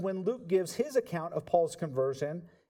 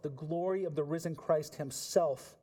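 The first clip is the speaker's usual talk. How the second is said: ordinary delivery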